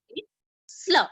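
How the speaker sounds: "slur" said as one blended sl sound. Shown as a demonstration. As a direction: glide s straight into l, as in "slur". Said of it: "then what it becomes?".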